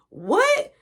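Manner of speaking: high, loud startled what; shocked what. Retold or imitated. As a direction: blurt it out stunned. Dad stunned by the news.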